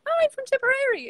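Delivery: very high, quick, loud quote; squealed retold announcement; excited exclamation. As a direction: imitate a stranger thrilled at the connection. Retold woman's excited reply.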